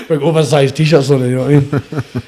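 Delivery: talking through a real laugh. Real, not acted. Laughing at his own frumpy t-shirt joke, laughing. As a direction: laugh your way through the words.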